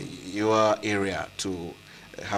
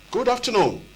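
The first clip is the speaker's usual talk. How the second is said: much higher call into the phone; 11 semitones higher than usual. Real, not acted. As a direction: call it out high and clear. Calling out over a bad line.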